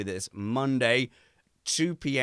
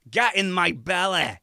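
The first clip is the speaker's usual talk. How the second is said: slow, higher, hammed-up Scottish "Get in my belly."; Scottish accent; 5 semitones higher, 4 dB louder than usual. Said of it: "that was too good".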